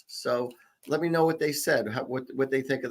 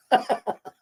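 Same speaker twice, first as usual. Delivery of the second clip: short high giggle; 11 semitones higher than usual. Real, not acted. Laughs at her own joke.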